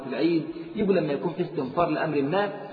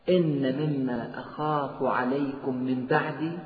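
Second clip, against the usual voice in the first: slow solemn recitation; 4 semitones lower, 3 dB quieter, much slower than usual. Acted. Hadith slow.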